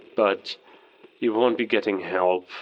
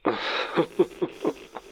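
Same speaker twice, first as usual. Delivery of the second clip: mocking laugh; 8 semitones higher than usual. Villain laughs over radio.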